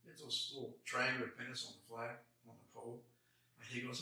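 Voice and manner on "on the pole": normal talking voice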